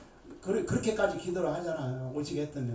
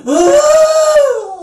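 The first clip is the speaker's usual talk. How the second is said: high loud wailing cry; preacher imitating her own wail; mock wail; 17 dB louder than usual. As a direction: let out a hammed-up lament. Wailing cry before complaint.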